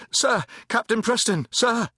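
high-pitched, eager calling out; urgent hail. A narrator's reading. Cornered private hails his captain, urgently. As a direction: call urgently to your officer.

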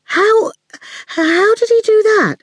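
high-pitched, stunned stutter; startled stammer. A narrator's reading. Fifi stammers after the knife throw.

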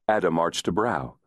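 plain reading voice

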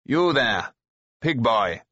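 lightly voiced haughty young rider; arrogant horseman. A narrator's reading. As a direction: call out with a touch of arrogance. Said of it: "The horseman's tone and bearing nettled Taran".

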